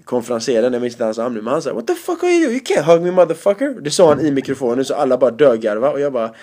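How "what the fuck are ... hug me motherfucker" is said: mock yell. Host quoted yelling in mic.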